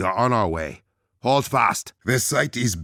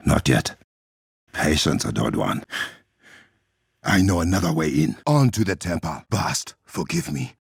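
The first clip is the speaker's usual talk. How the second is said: ordinary talk, nothing marked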